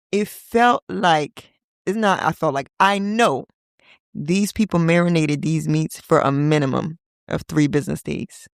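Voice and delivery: ordinary talk, nothing marked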